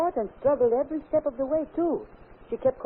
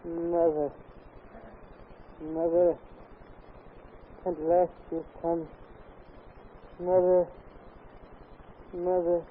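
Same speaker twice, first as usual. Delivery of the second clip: put-on lower, eerie man's voice coming from a girl; voice of a dead man; 6 semitones lower than usual. Dead son speaking through the girl to his mother, eerie man's voice. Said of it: "Her voice is changed. It is that of a man".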